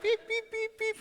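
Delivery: high, far-off robotic beeps; squeaky high reverse-alarm beeps; mock beeping. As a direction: beep like a backing truck, way up high. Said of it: "they'll be like".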